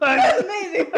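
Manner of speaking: loud, bubbling laugh through the words; laughing loudly. Real, not acted. Wife laughing loudly over the sandwich's taste. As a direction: burst out laughing with delight.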